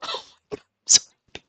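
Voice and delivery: unvoiced, tearful whisper; joyful whisper. Real, not acted. Gamer overjoyed her villager left, whispering. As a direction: breathe out your happiness.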